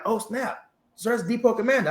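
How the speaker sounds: high, startled oh snap; a caught-out jolt of alarm; retold surprise. Realising the general is there.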